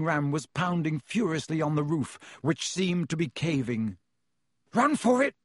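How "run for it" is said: high, urgent shout. A narrator's reading. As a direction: shout the order in a panic. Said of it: "Ron shouted".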